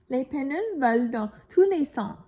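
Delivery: lively storybook read-aloud; reading to children. A narrator's reading. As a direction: tell it brightly to young listeners. Reads picture book to children.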